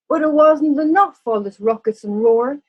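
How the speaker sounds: ordinary delivery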